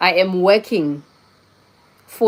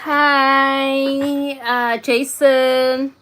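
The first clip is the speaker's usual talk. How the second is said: higher, very slow stretched greeting; drawn-out hello; 7 semitones higher, much slower than usual. Real, not acted. Streamer greets viewer Jason, slow and drawn out. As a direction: stretch the name out fondly.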